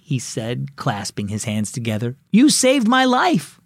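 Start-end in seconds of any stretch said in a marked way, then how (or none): 2.3-3.6 higher, slow, hammed-up gratitude; dramatic thanks. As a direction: overplay the relief. Boy hams gratitude to neighbor.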